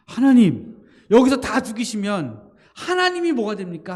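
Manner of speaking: loud, high pleading cry to God; Moses pleading. Retold or imitated. Preacher voicing Moses arguing with God, pleading loudly. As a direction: beg boldly, then drop to a plea.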